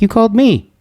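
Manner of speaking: high-pitched indignant comeback; retold protest. Himself objecting to the caller, high mock protest. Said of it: "And I'm like".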